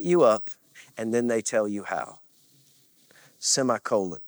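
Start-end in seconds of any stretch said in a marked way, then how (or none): none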